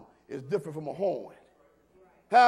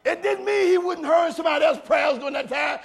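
loud, higher, climbing preacher cry; preaching at full force; 6 semitones higher, 9 dB louder than usual. Acted. Pastor crying out to the church about God hearing each voice, loud. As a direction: ride the rising shout of the sermon.

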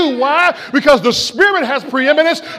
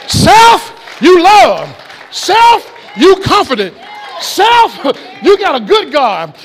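full-force preaching. Real, not acted. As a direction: whoop it up and rouse the congregation.

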